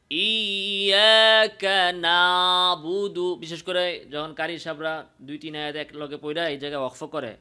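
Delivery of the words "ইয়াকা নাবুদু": ইয়াকা নাবুদু chanted with আইন made like a heavy আলিফ; common wrong recitation. Retold or imitated. Teacher imitating careless reciters, ইয়াকা নাবুদু with a weak আইন. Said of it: "অনেকে এইভাবে বলে".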